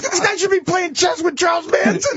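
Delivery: high, laughing rant; cracking up in mock panic; laughing outrage. Real, not acted. Comedian to hosts, laughing in mock panic. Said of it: "laughing in disbelief".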